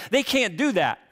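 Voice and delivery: ordinary talk, nothing marked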